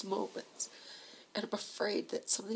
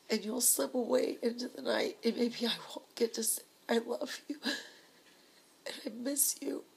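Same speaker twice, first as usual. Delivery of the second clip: tearful voice, higher and catching; crying; 4 semitones higher than usual. Real, not acted. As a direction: fight through real tears to say it. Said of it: "I'm sorry, I'm crying".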